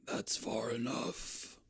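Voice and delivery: put-on slow raspy old whisper. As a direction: rasp it out like an old hermit. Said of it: "a raspy old voice that says".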